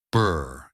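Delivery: lips buzzing brr; lip trill. Shown as a demonstration. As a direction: blow a child's lip trill. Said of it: "just like when you were a kid".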